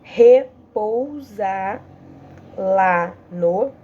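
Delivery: "Repousar lá no" drawn out very slowly; slow scansion. Shown as a demonstration. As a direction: stretch each syllable to count it. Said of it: "Agora pra outra". (escansão bem lenta)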